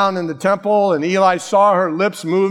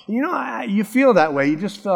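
ordinary talk, nothing marked

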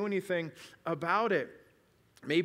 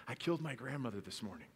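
soft, lowered secret voice; retold whisper; 4 semitones lower, 9 dB quieter than usual. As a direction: imitate a confidence slipped into an ear. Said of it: "he'd whisper in their ears".

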